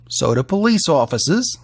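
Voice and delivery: ordinary delivery, nothing marked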